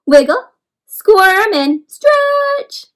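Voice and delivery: put-on leading the kids through the worm moves, stretch sung high; acting out the moves. Shown as a demonstration. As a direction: call the moves as you do them. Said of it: "Pretend you're putting your head in".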